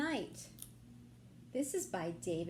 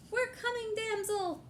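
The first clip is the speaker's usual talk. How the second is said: eager call; 12 semitones higher than usual. Acted. Knights answering damsel.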